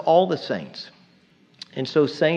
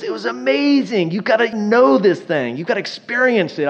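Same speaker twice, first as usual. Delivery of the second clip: higher, thrilled rave about the ride; excited big brother; 7 semitones higher than usual. Retold or imitated. Big brother raving to his kid brother, excited. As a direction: hype it up like a teen who just rode it. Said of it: "He goes".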